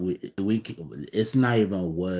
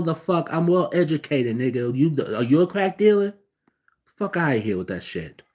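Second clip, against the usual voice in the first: ordinary talk, nothing marked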